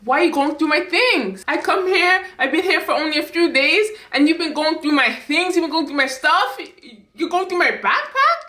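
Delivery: high, indignant tirade; so mad. Retold or imitated. Daughter who felt violated confronting mom over her things, mad. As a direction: pile up accusations, pitch climbing. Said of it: "I was so mad".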